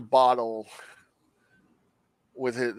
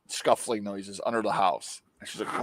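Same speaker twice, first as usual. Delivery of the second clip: ordinary talk, nothing marked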